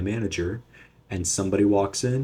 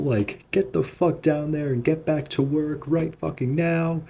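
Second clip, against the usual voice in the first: pitched way up, imitating a furious boss; angry manager rant; 9 semitones higher than usual. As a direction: scold them like a livid manager, high and pushed. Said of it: "and he's like".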